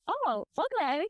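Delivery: imitated male customer. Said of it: "And he's like".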